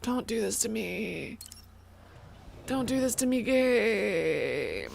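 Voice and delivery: soft, drawn-out whine; sad pleading. Real, not acted. Streamer begging the game to stop, softly plaintive. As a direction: plead weakly, stretching the last word.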